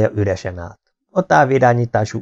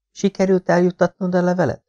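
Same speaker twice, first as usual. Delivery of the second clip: anxious wife; 9 semitones higher than usual. Acted. Wife asks husband anxiously.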